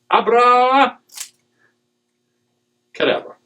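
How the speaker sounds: magician's flourish. Pretend.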